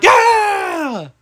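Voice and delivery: long, high-pitched mock cheer; retold team cheer. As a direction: cheer back like the whole team. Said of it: "And we were like".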